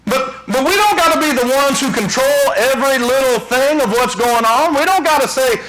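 high, ringing preacher's cry; fiery preaching. Acted. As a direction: preach it out, full force, to the congregation. Preaching at full force.

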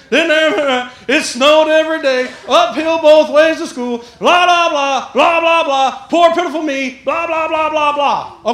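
loud, high, sing-along run of complaints; mock self-pity. Preacher mocking poor-me excuses, loud mock self-pity. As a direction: pile up the excuses in mock woe.

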